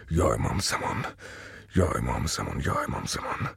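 very low, soft wailing plea; moaning prayer. Acted. Father moans to the Imam pacing in circles, very low and soft. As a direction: moan the plea in despair. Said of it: "مرتب مینالید".

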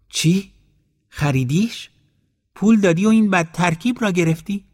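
can't believe he paid for it; shocked disbelief. Acted.

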